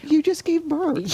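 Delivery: high incredulous exclamation. Retold or imitated. Mother reacting to daughter-in-law who just gave birth, incredulous and high. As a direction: her voice jumps up in disbelief. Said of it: "I'm thinking".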